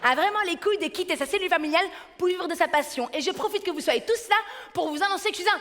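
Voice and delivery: eager brother. Retold or imitated. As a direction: rush it like a giddy little brother. Frère parle vite. (frère surexcité qui parle vite)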